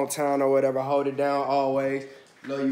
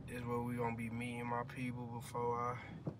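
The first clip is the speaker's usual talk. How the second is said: usual speaking voice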